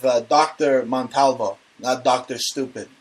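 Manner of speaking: ordinary delivery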